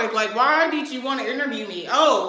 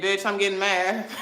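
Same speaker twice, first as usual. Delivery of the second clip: ordinary talk, nothing marked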